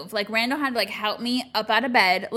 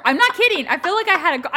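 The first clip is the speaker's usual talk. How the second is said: raised, emphatic insistence; insistent; 7 semitones higher, 7 dB louder, faster than usual. Real, not acted. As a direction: swear it's true, loudly.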